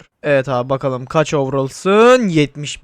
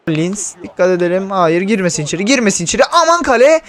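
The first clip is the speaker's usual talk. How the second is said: panicked yell; 5 semitones higher, 6 dB louder than usual. Real, not acted. Panic at opponent attack.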